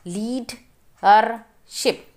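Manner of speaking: Leadership said slowly, syllable by syllable; slow syllable reading. Shown as a demonstration. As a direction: spell out leadership slowly in parts. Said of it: "Read the multi-syllable words".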